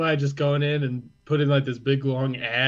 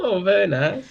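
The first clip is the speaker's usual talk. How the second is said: higher mock German accent; 4 semitones higher than usual. German gamer praising the play, mock German accent. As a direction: admire it in a put-on German accent. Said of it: "German accents".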